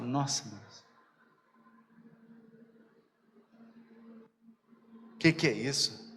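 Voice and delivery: muttered grumble. Acted. Passenger refused a stop by Jesus, acted muttered grumble. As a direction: grumble it under the breath.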